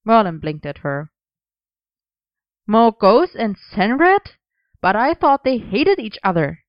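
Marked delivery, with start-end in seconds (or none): 2.7-6.6 surprised. Acted. Merlin shocked.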